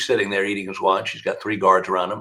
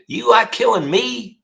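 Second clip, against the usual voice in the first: much higher, slow, drawn-out taunt; high-pitched Pee Wee; 12 semitones higher than usual. Retold or imitated. Killer teasing the prosecutor, high and slow. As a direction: stretch it out slyly and high. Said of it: "He said".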